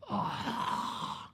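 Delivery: long airy groan of dread; dismayed groan. Retold or imitated. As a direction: let out a drawn-out, voiceless "oh". Dreads the unavoidable course.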